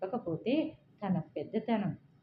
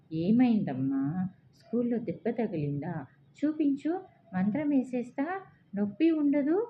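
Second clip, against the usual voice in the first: lightly acted coaxing, rising higher; grandma cajoling the boy; concerned grandmother; 5 semitones higher, 3 dB louder than usual. A narrator's reading. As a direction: soothe a hurt little child in a lifted voice. Grandmother comforts crying grandson.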